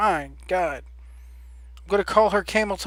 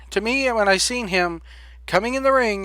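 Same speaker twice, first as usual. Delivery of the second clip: ordinary talk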